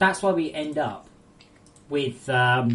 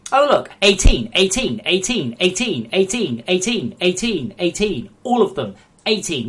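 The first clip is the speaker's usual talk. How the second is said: outraged; 2 semitones higher, 6 dB louder than usual. Real, not acted. Streamer reading out the game's age ratings, outraged. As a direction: point at every rating in disbelief.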